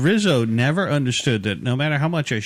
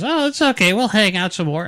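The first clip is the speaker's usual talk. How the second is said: much higher, mock girly reassurance; imitating the girl; 8 semitones higher than usual. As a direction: squeak it out like the girl he met. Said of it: "and she goes".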